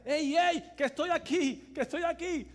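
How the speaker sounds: loud, high mock child's yell; calling out from hiding. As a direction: shout to the seeker that you're right here. (grito de niño escondido, algo actuado)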